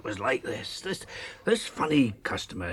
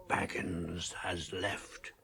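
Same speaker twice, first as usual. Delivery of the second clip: slow, hushed, much softer; whisper; 7 semitones lower, 10 dB quieter, much slower than usual. Retold or imitated. Maggot imitating the rider's slow hushed whisper. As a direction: hiss it low and slow. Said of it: "he answered in a whisper".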